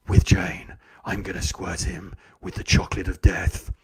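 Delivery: low villain's threat; hammed-up low menace; menacing bully. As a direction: sink into a dark, theatrical growl of doom. Bully's mock villain threat closes.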